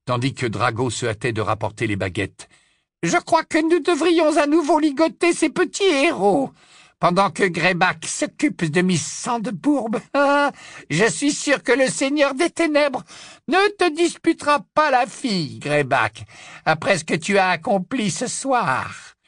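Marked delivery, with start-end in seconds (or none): 3.0-10.0 high, cruel witch voice, pleased with herself; high mocking dark witch; gloating witch. A narrator's reading. Bellatrix gloats over captives. 10.0-10.5 short high cackle; high evil cackle; witch's cackle. A narrator's reading. Bellatrix laughs mid-speech. 10.9-19.2 high, cruel witch voice, pleased with herself; high mocking dark witch; gloating witch. A narrator's reading. Bellatrix addresses Greyback.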